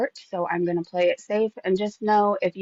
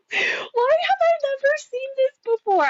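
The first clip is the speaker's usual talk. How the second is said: very high incredulous wail; real squeal of amazement; high-pitched disbelief. Real, not acted. Amazed reaction to the messages.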